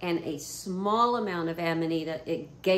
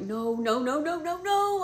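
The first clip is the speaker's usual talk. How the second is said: rising, panicking repeated no, climbing higher; panicked no's; 8 semitones higher than usual. Retold or imitated. To herself at the border, rising panic. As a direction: spiral up in panic, no after no. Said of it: "And I'm like".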